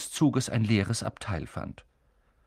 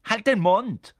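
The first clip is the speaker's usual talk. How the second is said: higher, louder snap back; angry retort; 11 semitones higher, 7 dB louder, slower than usual. Acted. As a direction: shut your brothers up. Ron snaps.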